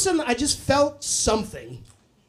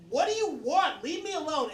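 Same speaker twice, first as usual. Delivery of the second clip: high, softer mock outburst; bratty retort; 6 semitones higher, 6 dB quieter than usual. Kid doubling down at mom, high exasperated mock retort. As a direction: act an exasperated kid, high but held back.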